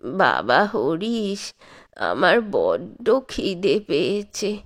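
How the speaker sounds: put-on very slow, drawn-out old man's call; an ancient man straining to hear his son; frail old grandfather. A narrator's reading. Very old grandfather calling son Harish, slow and frail.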